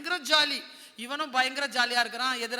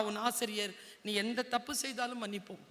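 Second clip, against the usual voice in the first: usual speaking voice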